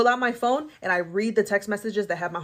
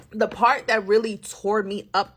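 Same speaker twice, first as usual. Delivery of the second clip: ordinary delivery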